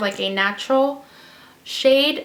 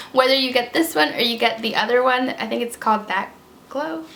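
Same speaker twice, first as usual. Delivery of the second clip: normal talking voice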